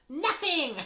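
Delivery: very high, loud gleeful shriek; laughing taunt. A narrator's reading. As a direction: burst out with mocking glee. Peeves tricks Filch, laughing.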